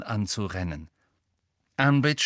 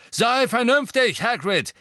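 man calling out; 12 semitones higher, 6 dB louder than usual. A narrator's reading. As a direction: call urgently across the grounds. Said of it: "Ein Mann rief".

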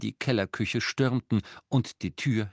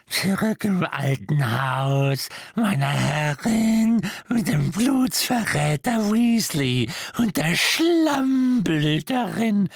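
slow, high, croaking elf muttering; 9 semitones higher, 5 dB louder, slower than usual. A narrator's reading. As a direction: grumble it into his knees. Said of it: "murmelte zu seinen Knien".

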